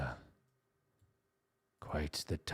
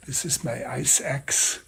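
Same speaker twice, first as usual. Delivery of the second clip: German accent; 10 semitones higher, 10 dB louder than usual. Shown as a demonstration. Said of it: "I think I need to be German".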